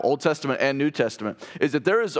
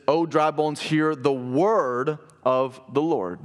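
slow proclamation; much slower than usual. Retold or imitated. Repeating the prophetic command.

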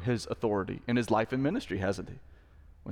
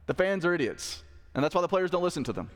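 ordinary delivery

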